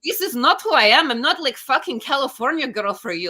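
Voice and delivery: hammed-up Russian accent telling off the fans; Natasha in a Russian accent. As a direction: play Natasha with a put-on Russian accent, tough and dismissive. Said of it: "started speaking in a Russian accent".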